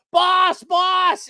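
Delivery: high, loud panicked call of boss; mock scream. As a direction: run yelling for the boss. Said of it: "run down the hall screaming".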